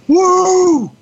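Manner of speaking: high, loud "woo" of a concert fan; piercing drawn-out cheer; crowd whoop. Retold or imitated. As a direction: whoop like someone in a live audience. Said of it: "you can hear someone just go".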